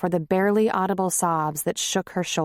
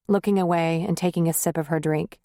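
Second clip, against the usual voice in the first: plain reading voice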